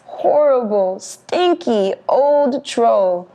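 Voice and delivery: ordinary delivery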